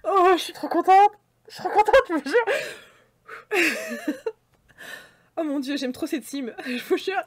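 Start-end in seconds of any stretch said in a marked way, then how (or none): 0.0-4.3 overjoyed. Real, not acted. Trop contente, crie de joie.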